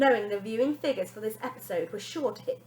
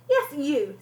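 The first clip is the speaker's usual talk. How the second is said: high, loud smug reply; nanny smirking at the girl; 7 semitones higher, 9 dB louder than usual. Acted. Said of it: "said the lady with a smirk".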